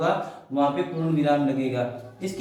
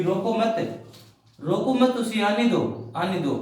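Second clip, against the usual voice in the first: "रोको मत" raised as one unit, then "आने दो" falling away; break after मत; 2 semitones higher than usual. Shown as a demonstration. Teacher showing the changed sentence, "रोको मत" grouped and raised. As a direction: keep "मत" tied to "रोको", settle down on "आने दो". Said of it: "कितना मतलब इनका सेंटेंस चेंज हो जाता है".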